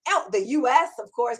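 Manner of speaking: ordinary talk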